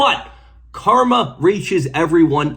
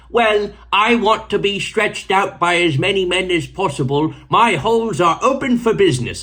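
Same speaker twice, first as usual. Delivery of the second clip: put-on posh 1920s British accent, pitched higher; mock old-timey British lady accent, higher; 5 semitones higher than usual. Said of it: "a nineteen twenties British accent".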